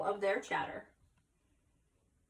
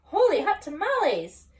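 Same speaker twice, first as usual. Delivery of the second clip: high, loud surprised exclamation; exclaiming; 8 semitones higher, 9 dB louder than usual. A narrator's reading. Skippy shoots past his reflection, loud excited exclaim. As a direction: blurt it out in delight. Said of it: "exclaimed Skippy John Jones".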